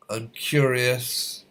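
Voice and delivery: ordinary talk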